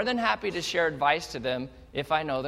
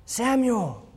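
voice of God calling; 5 semitones higher than usual. Acted. God calls again.

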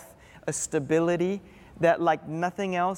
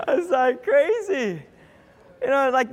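real laughter breaking into a higher voice; laughing; 6 semitones higher, 4 dB louder than usual. Real, not acted. Preacher amused by his own parenting story, laughing. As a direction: laugh through the words, pitched high.

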